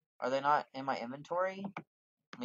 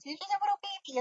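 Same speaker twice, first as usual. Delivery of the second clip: gushing. Real, not acted. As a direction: squeal at something adorable. Still gushing over creature.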